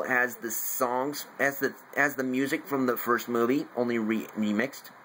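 ordinary talk

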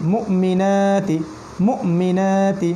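slow মুমিনাতি, broken at the hamza and stretched after it; push, then stretch. Shown as a demonstration. Teacher repeats the word for learners, jolted then drawn-out মুমিনাতি. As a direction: jolt after "mu" in মুমিনাতি, then hold the vowels long. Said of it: "এইভাবে".

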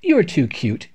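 high, slow, doting coo over a cute creature; a coot cooing in adoration; gushing emoting. A narrator's reading. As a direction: gush it out like a smitten bird. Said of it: "emoted the coot".

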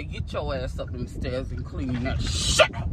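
low, quiet, menacing command; low stern mom threat; stern order. Pretend. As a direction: lay down the law to the kids, low and cold. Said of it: "I'm gonna say it just like that".

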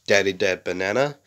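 usual speaking voice

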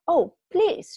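high and loud, pleading; anxious wife stopping her husband, high loud pleading; desperate cry. A narrator's reading. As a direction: beg him, panic rising. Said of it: "she cried".

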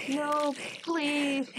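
high, pleading, half-laughing; horror game streamer. Real, not acted. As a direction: plead with mock terror. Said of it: "horror game streamer".

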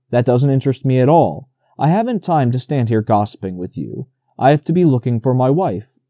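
plain reading voice

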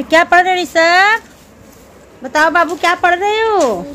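coaxing mother. Real, not acted. Mother asking her little son what he is studying, coaxing. Mother coaxes small child on camera.

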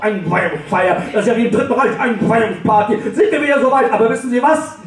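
self-righteous high-pitched rant; indignant rant. Acted. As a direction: scold her up the stairwell, winding up. Ranting up the stairwell.

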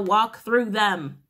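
spaced-out encouragement, every word weighted; 1 slow emphatic urging. Real, not acted. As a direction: press each word home to the listener. Urging the listener.

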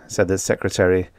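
ordinary delivery, nothing marked

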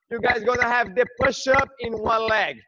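ordinary talk, nothing marked

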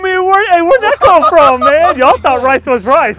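loud, high, stretched-out hype yelling; triumphant trash-talking yell; excited shout. Real, not acted. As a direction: crow over the shot at full volume. Hyped trash talk after great shot.

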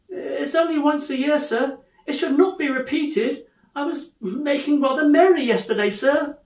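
high, pleading voice; meek apologetic clerk. Acted. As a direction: make nervous excuses to the boss.

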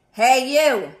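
loud, gruff challenge; grouchy shout. Acted. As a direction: bark it like a grumpy bug. Grouchy ladybug challenges elephant.

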